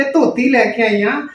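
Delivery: normal talking voice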